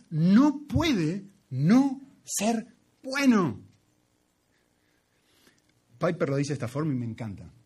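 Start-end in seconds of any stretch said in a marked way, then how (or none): 0.1-3.6 very slow, higher and louder, stretching "No puede no ser bueno"; drawn-out emphasis. Shown as a demonstration. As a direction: draw out every word of "No puede no ser bueno". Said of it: "el problema al revés".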